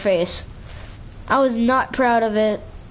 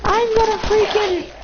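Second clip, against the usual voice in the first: mock girl voice; 9 semitones higher than usual. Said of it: "She was just like".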